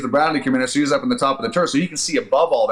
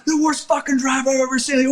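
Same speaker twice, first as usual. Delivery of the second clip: high, strained angry imitation; retold commander's tirade; cussing out; 12 semitones higher than usual. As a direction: tear into the driver, pitched way up. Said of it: "cussing him out".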